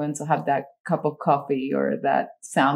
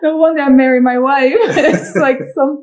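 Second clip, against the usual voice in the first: laughing while talking; 9 semitones higher, 9 dB louder than usual. Real, not acted. Laughing over friend's old joke.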